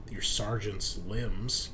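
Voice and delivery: ordinary talk, nothing marked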